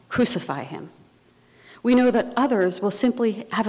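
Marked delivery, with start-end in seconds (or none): none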